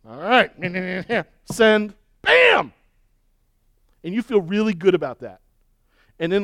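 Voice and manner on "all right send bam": hammed-up smug glee, ending in a high loud bam; gloating as the email fires off; smug mock send. Preacher acting out a smug reply email, hammed-up glee. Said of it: "you're like".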